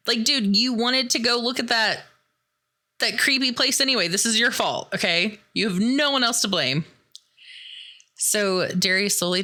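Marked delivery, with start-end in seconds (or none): 0.1-6.8 high-pitched indignant telling-off; hammed-up bickering, voice raised in pitch; mock blaming. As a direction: scold a friend in playful exasperation. Acted scolding in the quarrel.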